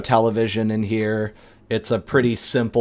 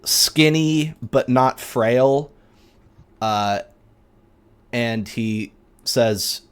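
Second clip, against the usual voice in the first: usual speaking voice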